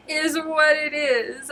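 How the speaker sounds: laughing through the words; cracking up mid-sentence. Real, not acted. Laughing at the phrase.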